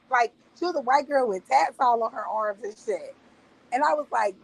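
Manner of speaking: ordinary talk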